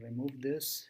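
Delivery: ordinary delivery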